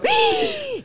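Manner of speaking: sharp high gasp of shock. Retold or imitated. At the dance on the boat, a scandalized gasp. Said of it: "Y yo".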